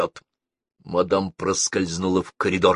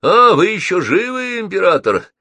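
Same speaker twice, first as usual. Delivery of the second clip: high, loud mocking question; mock surprise with a smirk; amused jeer; 11 semitones higher, 7 dB louder than usual. A narrator's reading. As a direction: taunt the fallen enemy in a raised voice. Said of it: "усмехнулся Геннадий".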